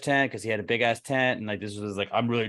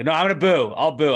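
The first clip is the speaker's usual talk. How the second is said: defiant; 5 semitones higher, 6 dB louder than usual. Retold or imitated. Defiant booing argument retold.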